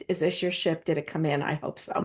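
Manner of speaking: soft, low, gentle reading of the note; hushed, tender quote of the boss's words. Retold or imitated. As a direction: read it softly like a kind mentor. Quoting the boss's kind note.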